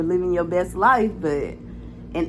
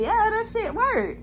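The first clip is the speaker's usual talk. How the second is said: coworker's reply; 12 semitones higher than usual. Retold or imitated. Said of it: "he was like".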